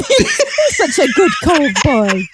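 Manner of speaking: put-on slow doting baby talk to a pet; slow pet-talk coo with a laugh; cooing at a dog.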